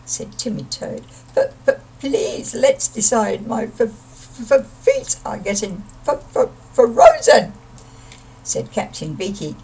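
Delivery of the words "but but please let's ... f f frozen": stammering from the cold, ending in a high squeak; shivering stammer. Acted. Freezing Toad begging friends to decide, shivering stammer. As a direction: stutter through chattering teeth.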